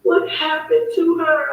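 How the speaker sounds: imitating a distraught cousin, high and drawn out; high, slow wail of a retold phone call; retold distressed cry. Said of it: "she was screaming and crying".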